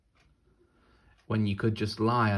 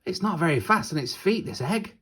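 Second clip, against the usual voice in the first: high, louder teasing wolf; 9 semitones higher, 5 dB louder than usual. Acted. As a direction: joke about the egg like a cheeky wolf. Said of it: "said the wolf".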